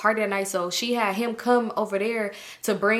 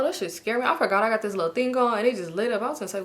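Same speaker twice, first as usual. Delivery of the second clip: ordinary delivery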